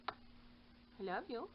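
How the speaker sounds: slow, soft loving words; tender to the kitten. Real, not acted. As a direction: coo it slowly and softly.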